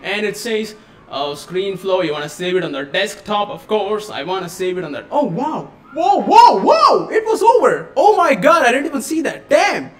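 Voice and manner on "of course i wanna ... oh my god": normal talking voice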